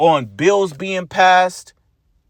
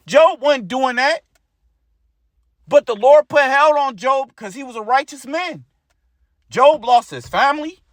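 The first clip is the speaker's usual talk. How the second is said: raised, building preacher's voice, driving each line home; fired-up preaching; 4 semitones higher than usual. Acted. Preacher telling brothers how Job suffered, fired-up and raised. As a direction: preach it like the pulpit's on fire.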